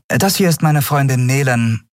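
calm and matter-of-fact; captain Andriel. Acted.

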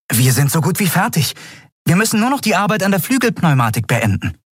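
put-on very high, bright boyish voice, delighted; eager boy Miko. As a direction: pitch way up like an excited young lad. Miko reports progress. (sehr hohe, freudige Jungenstimme)

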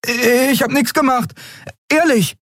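put-on very high, protesting boy voice; innocent boy. A narrator's reading. Miko protesting he did nothing, very high boyish voice. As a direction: plead innocence in a squeaky high voice.

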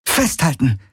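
high, urgent call at restrained volume. A narrator's reading. Rider warns her passenger to hold on, calling out. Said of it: "rief sie".

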